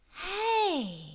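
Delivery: a high startled "Hey"; startled little girl; sudden realization. Acted. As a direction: catch yourself realizing. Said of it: "she told herself".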